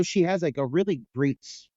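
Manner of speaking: ordinary talk, nothing marked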